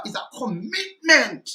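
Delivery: "commitment" drawn out slowly, pitched high on the middle syllable; stress on commitment. Real, not acted. Commentator reminding listeners of the promise, emphatic stretched "commitment". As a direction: punch and stretch the MIT in "commitment". Hammers the word from the clip.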